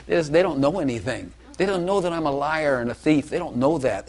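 normal talking voice

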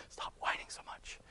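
breathy hushed aside; whisper. Real, not acted. Preacher hushing someone nearby, whispering. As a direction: drop to a whisper for a quick scold.

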